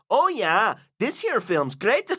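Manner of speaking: put-on high, slow go at a Minnesota accent; attempted North Central American accent. Host trying the Fargo film's dialect, shaky North Central American accent. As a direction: try a drawn-out North Central American accent, not quite convincingly. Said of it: "I can't do the accent".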